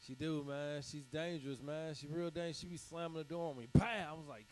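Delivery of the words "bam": slam sound. Retold or imitated. Door slammed, comic sound effect.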